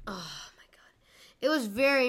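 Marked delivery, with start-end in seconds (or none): 0.1-0.9 breathy exasperated whisper. Real, not acted. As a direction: whisper it under your breath. Exasperated aside.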